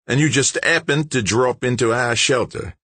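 high-pitched, doubting challenge; suspicious. A narrator's reading. Big George challenging the intruder's story, suspicious. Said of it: "his bearded face full of suspicion".

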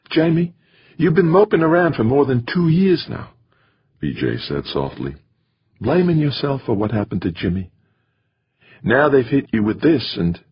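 VJ's voice. Acted. Wife urges Jamie to stop blaming himself, earnest. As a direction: gently press Jamie about his guilt. Woman speaks tenderly to Jamie.

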